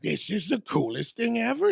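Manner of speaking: high, awestruck gushing; excited kid. Retold or imitated. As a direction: gush like a thrilled boy. Retold excitement of himself as kid.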